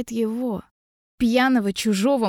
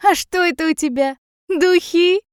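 higher, louder teasing question; mocking question; 8 semitones higher, 5 dB louder than usual. A narrator's reading. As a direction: tease with a high, loud last word. Guest mocks the girl's spray.

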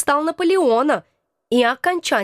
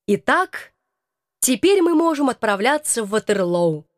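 usual speaking voice

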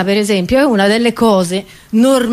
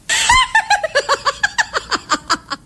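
long high staccato tittering laugh; high mock giggle; 3 dB quieter than usual. Comedian scoffs at desalination plants, high giggle. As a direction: titter in rapid high bursts.

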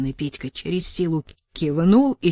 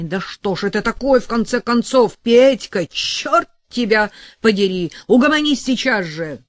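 higher and louder, furious at him; angry yelling; 6 semitones higher, 7 dB louder than usual. A narrator's reading. Sasha yelling at brawling Petka, high and loud fury. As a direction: snap at him to calm down.

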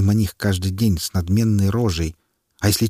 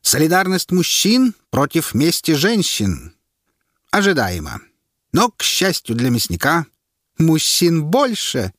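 high, slow pronouncement; herald's announcement; 8 semitones higher than usual. A narrator's reading. Herald sums up the vote to the crowd, slow and high. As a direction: sum it up for the crowd.

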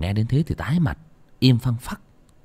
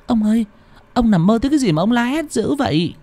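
much higher, concerned wife voice; worried wife; 11 semitones higher than usual. Acted. Wife asking her husband why he screamed, worried. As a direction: ask gently but anxiously. Wife asks about the nightmare.

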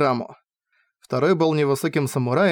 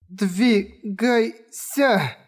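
commanding voice; 6 semitones higher, much slower than usual. Acted. Curse voice commands her.

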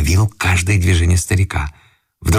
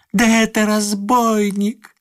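high, stretched-out exclamation; slow squeal of alarm; alarmed outcry; 12 semitones higher, slower than usual. A narrator's reading.

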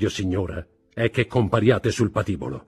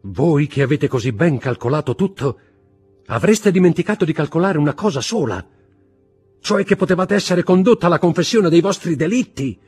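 loud tirade climbing ever higher; mounting exaltation; 7 semitones higher, 8 dB louder than usual. A narrator's reading. Husband lists his wife's crimes, with mounting exaltation. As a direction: build the accusation to a fevered peak. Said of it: "continuò Villefort con crescente esaltazione".

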